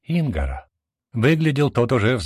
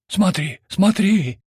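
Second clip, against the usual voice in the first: excited whispers; 11 semitones higher, 4 dB louder, slower than usual. A narrator's reading. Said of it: "пошли шепотки".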